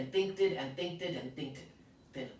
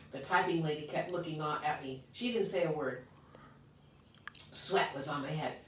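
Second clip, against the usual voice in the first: ordinary delivery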